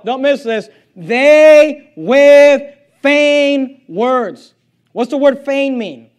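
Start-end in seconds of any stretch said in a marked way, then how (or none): 0.9-4.6 forceful scripture reading. Acted. Preacher reads verse with force.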